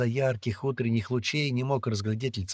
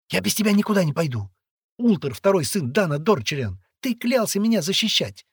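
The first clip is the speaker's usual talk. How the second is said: put-on high, loud girl's demand; insistent; 10 semitones higher, 6 dB louder than usual. A narrator's reading. Girl reminding boy of his oath, insistent. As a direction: hold him to it, voice raised and high.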